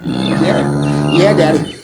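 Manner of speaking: very deep, growly put-on voice answering Daddy; Buttermilk answering. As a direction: drop way down and answer as the pet.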